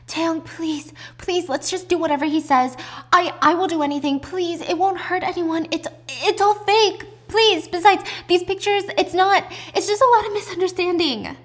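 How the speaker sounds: high-pitched, insistent begging; acted frantic pleading. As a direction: plead and explain in a panic. Acted girl begging Taeyong to comply.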